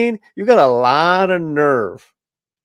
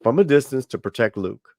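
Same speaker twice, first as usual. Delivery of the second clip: ordinary talk, nothing marked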